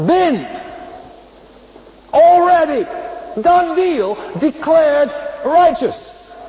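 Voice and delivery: the repeat preached at full force, climaxing in a shout; building to a shout. Shown as a demonstration. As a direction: build it up and shout the last words. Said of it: "Let me say it again".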